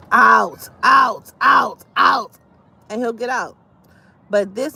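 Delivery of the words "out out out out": loud firm out out out; shouting at dog. Retold or imitated. To the dog, usual loud commanding shout. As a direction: order the dog out of the room. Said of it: "Usually, I would be like".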